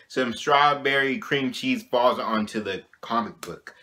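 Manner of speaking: ordinary talk, nothing marked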